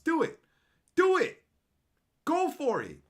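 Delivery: high loud goading; taunting dare. Acted. Daring chat to use emojis, high loud goading.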